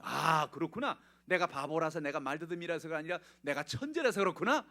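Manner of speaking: light up as it dawns; boy's realisation. Retold or imitated. Young Edison realises he is a genius, bright and loud.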